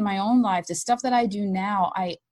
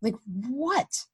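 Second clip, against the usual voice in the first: higher, bewildered questioning of her son; puzzled question; 5 semitones higher than usual. Retold or imitated. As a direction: mom baffled by her son's joy. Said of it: "And I'm like".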